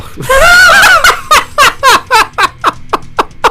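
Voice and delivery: shrieking cackle of disbelief. Real, not acted. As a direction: howl with laughter. Cracking up at the zombies.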